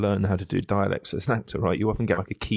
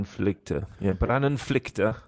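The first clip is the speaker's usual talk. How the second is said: Brandonflykte said very slowly in a bouncy mock Norwegian lilt; mock Norwegian accent; slower than usual. Shown as a demonstration. Host trying out a Norwegian word, hammed-up Norwegian accent. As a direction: play at a Norwegian accent, slow and singsong. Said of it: "some ridiculous sort of rhythm".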